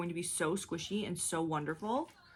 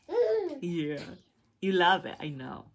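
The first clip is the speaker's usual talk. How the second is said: ordinary talk, nothing marked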